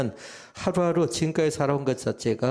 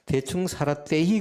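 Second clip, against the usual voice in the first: higher-pitched Gyeongsang dialect imitation; 5 semitones higher than usual. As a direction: give the second Gyeongsang version, pitched up. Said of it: "경상도 버전은 두 가지가 나오더라고요".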